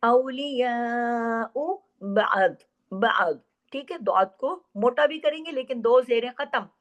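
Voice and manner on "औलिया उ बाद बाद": very slow chanted recitation; Quran recitation. Shown as a demonstration. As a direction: recite with idgham, drawn out. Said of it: "आर्जी सुकून किया है". (धीमी तजवीद वाली तिलावत)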